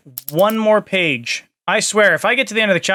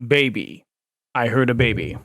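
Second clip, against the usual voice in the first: deep voice; 6 semitones lower than usual. Shown as a demonstration. Streamer hearing his baby, still very deep. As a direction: keep the deep voice going.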